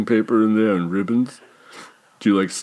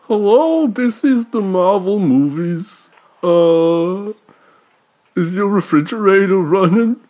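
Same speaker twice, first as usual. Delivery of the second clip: much higher, slow fake voice; high disguised voice; 9 semitones higher, slower than usual. Fake Marvel caller voice.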